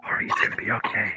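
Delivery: quick, soft whispered reassurance; hushed and hurried; whispering. Acted. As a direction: whisper to comfort a friend. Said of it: "Why are you guys whispering?".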